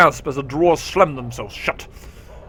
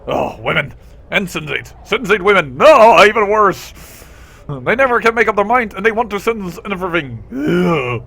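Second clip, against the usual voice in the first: exasperated rant; 5 semitones higher, 7 dB louder than usual. Acted. Maud rants about women to the party, loud. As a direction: rail at women theatrically.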